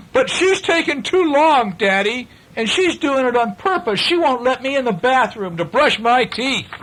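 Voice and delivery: put-on high, complaining little boy; whining boy. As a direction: tattle on your sister to Daddy. Boy whines to Daddy.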